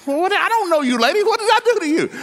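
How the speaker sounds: laughing incredulous shout. Retold or imitated. Man at the rally laughing as he asks a woman what he did to her. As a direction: laugh out your baffled protest.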